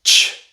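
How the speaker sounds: crisp ch on its own; ch sound. Shown as a demonstration. Teacher sounding out "Ch" for learners, crisp. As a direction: sound out "Ch" as a clean ch. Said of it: "make the ch sound".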